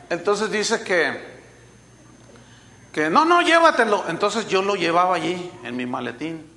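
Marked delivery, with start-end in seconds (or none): 3.1-4.0 louder, higher retold urging; mock insistent. Someone pushing a disc on the emailer, insistent. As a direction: press it on them in a raised voice.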